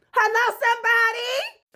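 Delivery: high, loud call for agreement; preacher call-out. Real, not acted. Vlogger calling out to viewers for agreement. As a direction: call out to the crowd.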